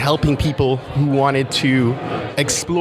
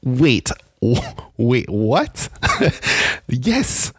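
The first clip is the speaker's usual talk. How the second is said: stunned delight; much slower than usual. Retold or imitated. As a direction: act out stunned surprise, then celebrate. Reenacts reacting to winning text.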